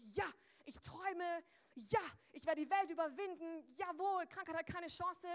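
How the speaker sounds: high, loud, drawn-out hype; pumped-up cheering. Acted. Preacher acting a pumped-up believer to the congregation, hyped. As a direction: cheer like a fired-up athlete.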